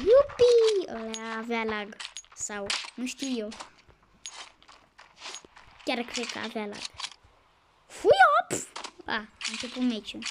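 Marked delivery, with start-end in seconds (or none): 0.0-0.9 high, loud yippee; gleeful cheer. Real, not acted. Cheers during play. 7.9-8.6 high loud "hui-op"; playful whoop. Real, not acted. Whoops during play.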